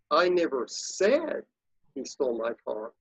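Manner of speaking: "said" stretched and lifted, then drop away; stress on SAID. Shown as a demonstration. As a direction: put the emphasis on the word "said". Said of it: "say that sentence quickly seven different ways".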